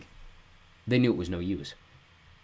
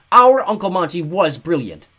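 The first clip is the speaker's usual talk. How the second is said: slow, loud and firm; firmly; 9 semitones higher, 12 dB louder, slower than usual. A narrator's reading. Klaus defending Uncle Monty to Bruce, slow and firm. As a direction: insist on every word. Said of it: "he said firmly".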